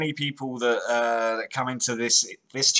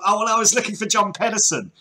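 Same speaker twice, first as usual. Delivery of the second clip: high, louder mock excuse; mock excuse-maker; 8 semitones higher, 5 dB louder than usual. Host imitating a caught man's changing story, mock excuse. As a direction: act out a caught man's lame alibi. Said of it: "one minute it's like".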